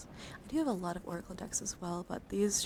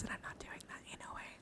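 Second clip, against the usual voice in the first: hushed, hurried throwaway whisper; whispered aside; 12 dB quieter, faster than usual. Real, not acted. Streamer dismissing a pricey deck, whispered aside. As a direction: drop to a quick whisper.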